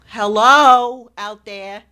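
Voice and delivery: loud, drawn-out hail to listeners; calling out. Real, not acted. Speaker hails radio listeners to wake them up, calling out loud. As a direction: call out slowly to everyone listening.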